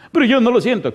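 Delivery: high, loud objection; protesting. Retold or imitated. Woman objects to pastor's order to forgive, protesting. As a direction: push back in an acted, indignant protest.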